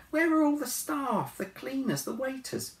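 put-on very high, demanding girl; Anna's voice. A narrator's reading. Anna demands.

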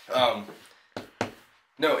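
ordinary talk, nothing marked

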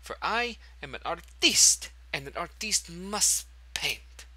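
hammy French artiste declaring his calling; pompous French accent, slowed on the second line; French artist accent. Acted. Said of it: "I don't know why I got that accent there".

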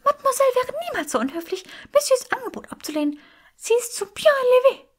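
sehr hoch und schnell plappernd; begeisterte Elfe. A narrator's reading. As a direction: eifrige Hauselfe schwärmt von der Mademoiselle. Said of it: "rief Henriette mit strahlenden Augen". (hohe, hastige Elfenstimme voller Begeisterung)

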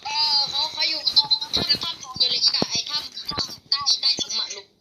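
high, loud, slow urgent call; urgent excited shout. Real, not acted. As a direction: shout directions in a hurry. Urgent directions during chase. (ตะโกนบอกทางอย่างร้อนรน)

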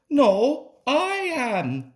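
high, insistent comeback with a loud "no"; wheezed retort. A narrator's reading. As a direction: counter high, hitting the first word loud. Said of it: "wheezed Rheneas".

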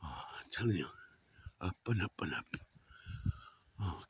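out of breath from the climb; panting. Real, not acted. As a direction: puff the words out softly, breath short. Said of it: "I'm panting already".

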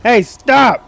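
real yell of protest; alarmed shout. Real, not acted. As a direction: shout at them to stop. Yelling at attacker in game.

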